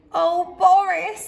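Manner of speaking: a kindly teacher sighing at Boris; Miss Cluck fond sigh. A narrator's reading. Teacher sighing fondly at clumsy Boris, drawn out. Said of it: "said Miss Cluck".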